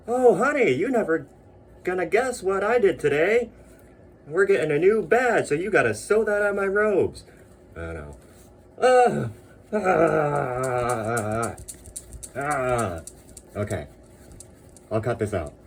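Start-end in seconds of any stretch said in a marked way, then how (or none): 0.1-7.2 high, loud gushing to his wife; excited official. Pretend. Promoted official telling his wife, high and thrilled. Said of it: "They're like". 8.8-13.0 high loud shriek of joy; joyful scream. Real, not acted. Unboxing badges, screaming with delight. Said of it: "I'll just stop screaming".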